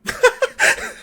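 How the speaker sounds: high-pitched cackle; acted high laughter; guard's mocking laugh. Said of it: "This is the guard laughing".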